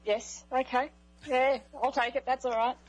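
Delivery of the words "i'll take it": usual speaking voice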